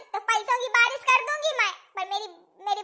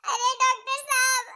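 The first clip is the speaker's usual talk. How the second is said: ordinary delivery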